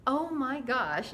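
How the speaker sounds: ordinary delivery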